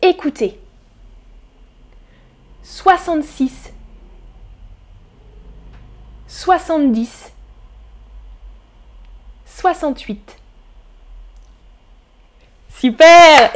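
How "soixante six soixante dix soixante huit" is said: soixante-six, soixante-dix, soixante-huit each said alone after a long pause; listening quiz read. Shown as a demonstration. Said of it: "Je répète". (lecture lente des options, avec des pauses)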